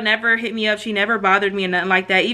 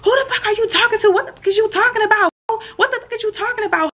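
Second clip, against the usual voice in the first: very high-pitched mock outrage; imitated teeing up; 12 semitones higher than usual. Other girl going off on the narrator, high mock outrage. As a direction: mimic her going off in a squeal. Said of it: "she starts teeing up on me".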